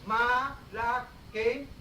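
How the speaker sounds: malakain drawn out very slowly, syllable by syllable; slow Arabic word. Shown as a demonstration. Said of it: "The root is".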